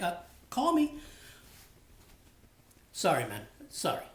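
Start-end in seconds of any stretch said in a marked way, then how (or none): 0.0-1.0 higher, offhand imitated friend; Pabs. Friend Pabs telling the host to just call, raised imitated voice. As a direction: toss it off like a buddy in a raised imitation. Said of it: "He's like".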